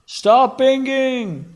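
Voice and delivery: loud, high, slow yell; yelling at the oven. Real, not acted. Yells at beeping oven.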